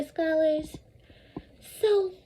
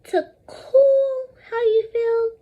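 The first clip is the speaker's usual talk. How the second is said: slow, high "To cool how you feel"; slow emphatic definition; 9 semitones higher, 5 dB louder, slower than usual. Shown as a demonstration. Teacher modelling the definition for repetition. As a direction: stretch each word out for the class. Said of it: "First I say, then you say".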